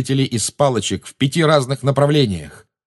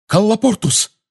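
high, breathless blurted spell; urgent high-pitched gasp of a spell; 11 semitones higher than usual. A narrator's reading. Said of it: "задыхаясь, выпалила Гермиона".

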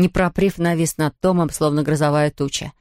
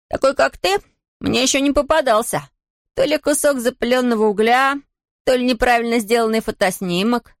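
mock the ghost, higher pitched; taunting shout; 7 semitones higher than usual. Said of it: "крикнул Том".

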